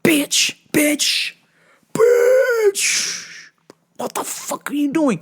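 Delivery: high-pitched, drawn-out incredulous exclamations; retold outrage. Store worker reacting to a careless mother, outraged disbelief. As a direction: stretch the words in high, exasperated disbelief.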